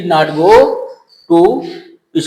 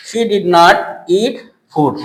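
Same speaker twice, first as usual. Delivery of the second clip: slowly, word by word; slow dictation; much slower than usual. Shown as a demonstration. Teacher reading the finished sentence to students, slowly. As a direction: read the full answer for copying. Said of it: "building the sentence".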